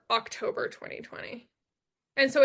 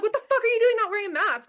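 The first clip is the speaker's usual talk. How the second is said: higher, louder and fast angry yell; retold freak-out; 9 semitones higher, 5 dB louder, faster than usual. Daughter yelling at her mother for not wearing a mask. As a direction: snap at her in disbelief. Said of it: "I freak out at her".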